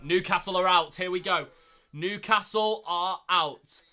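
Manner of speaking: loud, excited draw reaction. Real, not acted. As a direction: announce the big news with force. Newcastle drawn in live draw.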